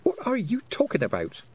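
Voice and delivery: high, puzzled demand; frowning. A narrator's reading. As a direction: question it with a baffled frown. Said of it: "said Tiffany, frowning".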